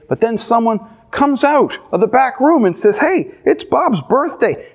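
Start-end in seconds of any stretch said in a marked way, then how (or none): 3.0-4.7 cheery announcement. Acted. Coworker announces party.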